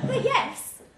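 breaks into a laugh mid-line, then laughs out the last words. Acted. Woman laughing at her own joke to him. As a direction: let the laugh bubble through the words.

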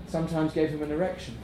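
ordinary delivery, nothing marked

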